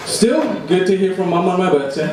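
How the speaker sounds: ordinary talk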